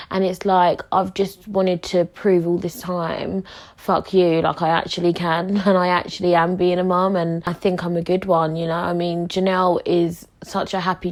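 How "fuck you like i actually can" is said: usual speaking voice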